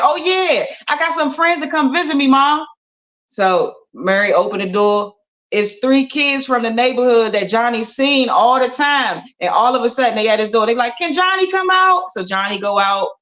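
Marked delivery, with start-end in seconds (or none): 0.0-2.6 excited. Acted. Johnny remembers wishes. 11.0-12.1 put-on kids asking. Kids at the door.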